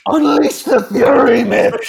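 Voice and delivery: high, drawn-out battle cry; full-throated yell. Acted. Quoting a movie to a co-host, full-throated shout. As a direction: yell it slow and huge, voice way up. Movie quote yelled as a character.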